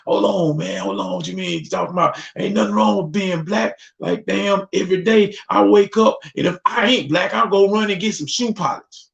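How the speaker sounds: put-on high, excited older man; older brother. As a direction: exclaim it up high like a proud elder. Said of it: "he was like".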